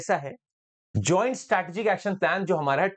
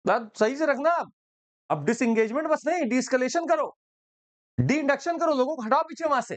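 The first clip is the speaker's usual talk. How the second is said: higher, commanding minister barking orders; bossy orders; 5 semitones higher than usual. Retold or imitated. Jaishankar ordering Wang to pull troops back, higher commanding voice. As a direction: lay down the law like a boss. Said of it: "इतना तेज क्यों बोल रहे हैं आप?".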